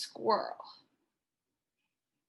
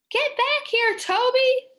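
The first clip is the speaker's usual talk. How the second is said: high, loud call after a runaway dog; yelled; 12 semitones higher, 7 dB louder than usual. Shown as a demonstration. Teacher yelling at the runaway dog Toby, loud. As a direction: shout it across the park. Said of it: "I yelled".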